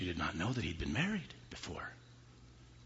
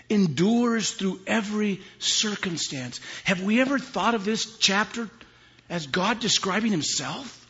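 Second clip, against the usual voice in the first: high, loud, slow preaching; full-force preaching; 13 semitones higher, 12 dB louder than usual. Real, not acted. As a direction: thunder it out.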